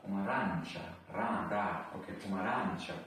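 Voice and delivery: "Pomarańcza" with a rolled "ra" and "cza" as /tʃa/. Shown as a demonstration. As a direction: hit the "ra" in "Pomarańcza" hard. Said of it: "repeats app".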